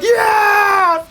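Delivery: very high, drawn-out cheering shout; imitated crowd cheer. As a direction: cheer like a whole arena, imitated. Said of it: "everyone was just cheering".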